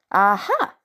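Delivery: a Thor voice, pleased discovery; Thor's aha. Acted. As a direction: say aha like the god who just got it.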